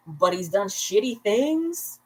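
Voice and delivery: ordinary talk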